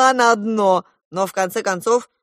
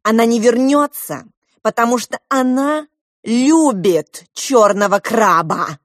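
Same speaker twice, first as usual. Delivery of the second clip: raised, loud, slowing declaration; vehement; 5 semitones higher, 8 dB louder than usual. A narrator's reading. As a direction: drive the bad news home loudly. Diana spitefully breaks the bad news. (громко, с напором)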